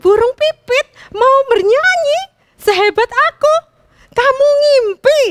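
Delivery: high, loud, arrogant bird voice; mock the sparrow from above; boastful sneer. A narrator's reading. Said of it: "dengan bangga dan dengan sombongnya".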